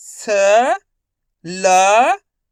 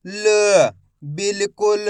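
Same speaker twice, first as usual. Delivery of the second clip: ordinary delivery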